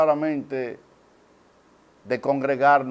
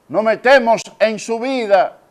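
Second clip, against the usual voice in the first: raised, louder and higher; emphatic preaching; 8 semitones higher, 7 dB louder than usual. Real, not acted. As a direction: drive it home to the congregation. Preacher repeats it more forcefully. (predicación enfática, con voz alzada)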